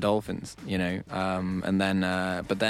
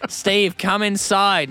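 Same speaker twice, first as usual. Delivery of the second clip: calling out; 9 semitones higher, 5 dB louder, much slower than usual. Retold or imitated.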